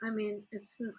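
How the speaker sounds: ordinary talk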